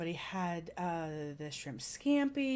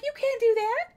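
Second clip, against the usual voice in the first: incredulous protest; 9 dB louder than usual. Retold or imitated. Retold protest at his joke.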